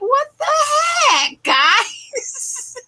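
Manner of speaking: very high, drawn-out squeal of disbelief; hammed-up incredulous cry, laughing at the end; mock bafflement. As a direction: stretch it out in exaggerated dismay. Mock bafflement at the microwave keypad.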